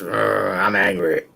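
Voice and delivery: slow, low, deadpan angry grumble; mock angry grumble. Co-host imitating angry Tenay face, slow deadpan grumble. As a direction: play the sulky angry face. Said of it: "it was just".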